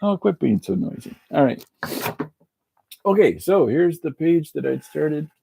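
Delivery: broad, folksy Canadian hoser talk; chatty hoser with a heavy Canadian lilt; Canadian hoser accent. Pretend. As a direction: lay on the Canadian accent thick.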